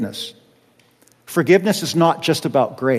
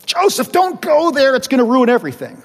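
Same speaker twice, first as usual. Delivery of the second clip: anxious warning voice; 8 semitones higher, faster than usual. Pretend. Imagined voice warning Joseph.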